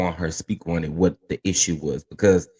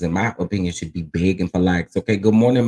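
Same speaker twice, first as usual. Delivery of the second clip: ordinary delivery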